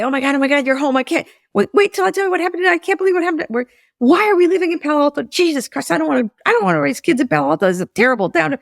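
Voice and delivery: high, fast, breathless outpouring; a flustered mom unloading her day at once; excited rush. Retold or imitated. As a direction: gush it all out in one go. Bursting out to husband after day.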